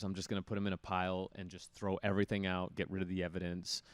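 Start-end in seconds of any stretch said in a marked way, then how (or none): none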